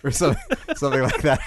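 laughing it off; breaking into laughter. Real, not acted. Laughs after the announcer bit.

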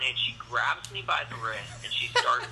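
ordinary talk

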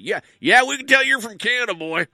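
loud, high mock Southern American; Southern US accent. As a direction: holler it like a caricature Southerner. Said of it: "especially in the southern United States".

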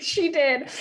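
usual speaking voice

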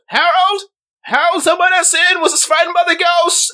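put-on old neighbor yelling across the street; shrill old woman calling. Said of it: "he goes".

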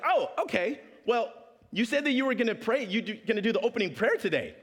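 prim, pushy schoolmate asking; Janessa's voice. Retold or imitated. Janessa voiced pushing for prayer.

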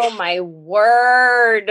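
slow, drawn-out oh my word; stunned exclamation. Real, not acted. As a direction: let it out slowly, amazed. Reacting to the drunk-girl politics bit.

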